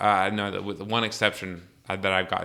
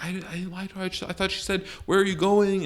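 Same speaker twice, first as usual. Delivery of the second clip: put-on high-pitched, defensive kid; mock pleading; 10 semitones higher than usual. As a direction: imitate a teen protesting innocence. Retelling his plea to mom.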